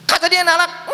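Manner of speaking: high, loud insistence; indignant mother-in-law. Acted. Mother repeating daughter's claim, indignant. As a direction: throw it back at him, pitched high.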